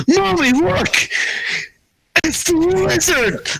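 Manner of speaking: put-on high, squealing little-kid voice; excited child. Child pointing out the lizard to Mommy, high and excited. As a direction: pipe up like a thrilled small child. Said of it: "the child is like".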